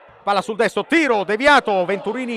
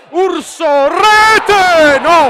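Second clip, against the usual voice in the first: shouting a goal, high and loud; goal call shout; 9 semitones higher, 12 dB louder, much slower than usual. Real, not acted. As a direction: yell the goal at full voice.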